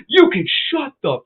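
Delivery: high-pitched heated yelling back; retold angry shouting. Shouting back at the driver in the road, angry and high. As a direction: throw the insult back hard and high.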